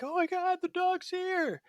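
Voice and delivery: very high, slow, breathless mock panic; waking panic. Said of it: "I wake up in this, like, panic".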